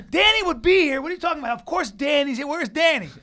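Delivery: high, loud incredulous questioning; retold worked-up protest; agitated insisting. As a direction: demand to know, not buying the excuse. Retold insisting on finding Danny.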